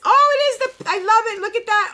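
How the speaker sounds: high-pitched gush of delight; excited delight. Real, not acted. Vlogger seeing her new lip color, excited delight. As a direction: burst out high, thrilled by it. Delighted at first look at product.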